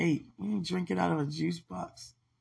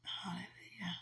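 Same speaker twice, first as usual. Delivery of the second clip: whispered hallelujah; 3 semitones higher, 12 dB quieter than usual. Real, not acted. Quiet whispered praise.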